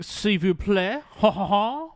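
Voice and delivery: high, slow snooty French voice; mock French teacher. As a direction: sneer it like a pompous Frenchman. Mocking French teacher impression.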